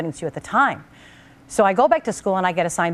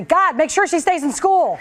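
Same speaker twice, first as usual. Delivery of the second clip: dad's voice much higher, slow and earnest; dad pleading; 10 semitones higher, 4 dB louder, slower than usual. Retold or imitated. Dad in heaven asking God to keep her in school, slow and high. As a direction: beg God slowly on her behalf. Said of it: "the first thing he did was say".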